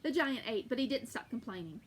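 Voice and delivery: plain reading voice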